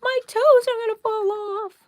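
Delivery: high, slow, squealing panic over the cold; hammed-up shriek about the cold; mock freezing Texan. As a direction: squeal like your toes are freezing off. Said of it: "and we're like".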